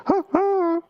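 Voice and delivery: high, clipped "ha, ha" chuckle; a tight little held-in laugh; dry inward laugh. Retold or imitated. Mimicking the comic's inward laugh on stage, dry chuckle. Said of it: "that inward laugh".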